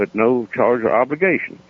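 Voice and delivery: ordinary delivery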